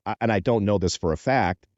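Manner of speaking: normal talking voice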